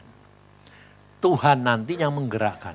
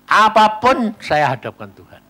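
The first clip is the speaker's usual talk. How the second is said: preaching; 6 semitones higher, 9 dB louder than usual. Acted. Preacher declaring faith.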